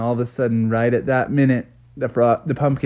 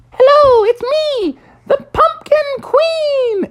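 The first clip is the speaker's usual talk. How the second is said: very high, loud and slow queenly pumpkin; Mommy's pumpkin introducing itself in a squeaky royal voice; 4 dB louder, slower than usual. Acted. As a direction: sing out the greeting like a tiny queen. Mommy's pumpkin comes to life.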